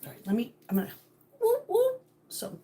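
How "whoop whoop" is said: loud high whooping cry. Acted. Host warning viewers to plug their ears, whooping. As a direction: whoop it out like a siren. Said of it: "plug your ears, people".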